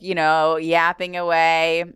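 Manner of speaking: ordinary talk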